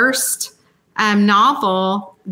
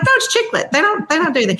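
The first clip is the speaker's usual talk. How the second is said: high-pitched insistent protest, imitating herself; mock-indignant retort; 8 semitones higher, faster than usual. To the author doubting her genre, high mock protest. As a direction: insist it's chick lit, voice jumping up. Retelling arguing with fellow author.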